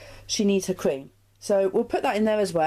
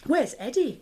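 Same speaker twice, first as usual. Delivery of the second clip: higher, anxious call with a rise; worried cry; 7 semitones higher, 4 dB louder than usual. Acted. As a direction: call out for the lost gecko. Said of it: "cries Ellie".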